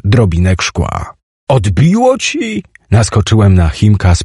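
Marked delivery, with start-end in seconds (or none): 1.5-2.6 high, drawn-out indignant question; outraged disbelief. Acted. Narrator lunges at Chimek after the explosion, outraged and high. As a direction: challenge a friend as if he's gone mad. Angry outburst after a near accident.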